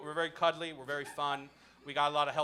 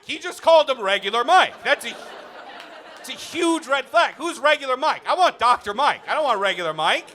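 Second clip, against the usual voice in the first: loud outraged rant; 7 semitones higher, 8 dB louder than usual. Real, not acted. Outraged about regular Mike.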